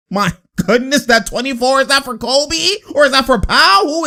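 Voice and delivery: high-pitched incredulous questioning; amazed. Real, not acted. Amazed at a great play.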